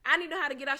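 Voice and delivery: put-on urgent childish plea; excited child. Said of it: "I'm like".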